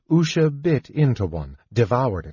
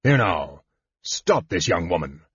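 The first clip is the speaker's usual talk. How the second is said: lower, lightly voiced firm command; a hint of a stern official stepping in; firm guardsman; 5 semitones lower than usual. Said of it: "a clear, firm voice said".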